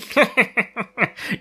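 high, villainous cackling laugh; evil cackle. Retold or imitated. Villain cackles before swearing revenge, evil cackle. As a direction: cackle like a gleeful madman. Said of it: "cackling away".

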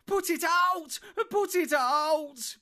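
high, loud drawn-out scream; desperate begging. A narrator's reading. Trapped hobbit begging for the fire out, screaming. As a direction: beg at full voice, slowing on the repeat. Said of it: "begged Merry".

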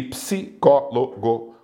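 psicólogo counted out as psi-có-lo-go; psicólogo split in four, ps kept together; syllable by syllable. Shown as a demonstration. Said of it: "quatro sílabas".

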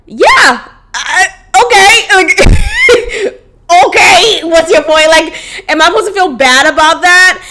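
incredulous laughing. Real, not acted. Mocking ex's message, incredulous.

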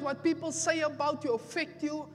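fiery pulpit exhortation; preaching at full force. Acted. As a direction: exhort the church, building each line.

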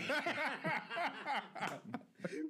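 high-pitched burst of laughter; big laugh. Real, not acted. Co-host cracks up at his own joke, laughing.

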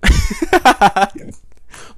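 high, loud real laughter; bursting laugh. Real, not acted. Vlogger hearing own accent, bursting laugh.

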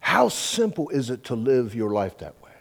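ordinary talk

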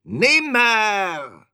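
very high, loud raven screech; piercing raven's cry; croaking raven. A narrator's reading. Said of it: "krächzte da der Rabe".

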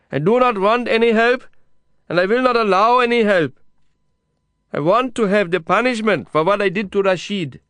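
high, loud stubborn refusal; defiant shouting. A narrator's reading. Kasim rejects the journalist's help, defiant shout. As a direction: hold your ground at full voice.

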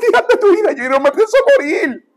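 high-pitched exaggerated lament, imitating pastors; mock wailing pastors. Church pastors lamenting over a dying member, mock wailing. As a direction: wail it like a melodramatic pastor.

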